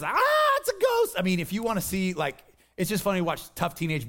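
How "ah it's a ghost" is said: mock terrified shriek, much higher; hammed-up ghost scare; scared shriek. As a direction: shriek like a spooked teenage boy. Boys scared by ghost on water.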